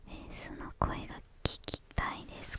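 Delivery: usual speaking voice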